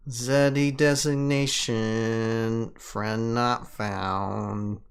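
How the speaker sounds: slow, even machine readout; robot voice. Acted. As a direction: read it like a lazy robot. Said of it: "You gotta do the robot voice".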